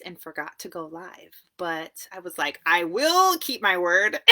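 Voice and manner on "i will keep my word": loud, drawn-out playful declaration; mock-solemn promise, louder and slower; emphatic vow. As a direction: proclaim it slowly, stretching the words. Quoting her own vow to herself.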